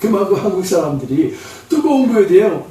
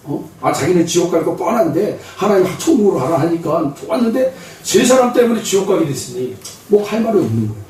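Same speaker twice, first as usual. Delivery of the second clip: ordinary talk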